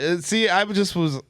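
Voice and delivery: usual speaking voice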